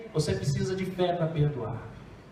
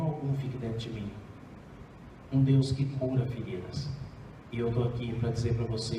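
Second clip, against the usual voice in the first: lower, softer, tender counsel; gentle fatherly God; 6 semitones lower, 4 dB quieter than usual. Acted. The Lord teaching his child to forgive, tenderly. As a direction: speak like a patient father guiding his child.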